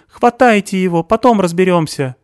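high, loud hysterical accusations; panicked screaming; hysterical shouting. A narrator's reading. Stasik shouting orders.

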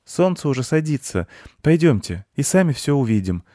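put-on high boy's call to his friends. A narrator's reading. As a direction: call them to come along. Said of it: "позвал их мальчик". (зовёт друзей, звонко)

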